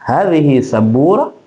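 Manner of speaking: "Hadhihi sabura" rising at the end; questioning tune on "Hadhihi sabura"; question intonation. Shown as a demonstration. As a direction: ask "Hadhihi sabura", lifting the last word.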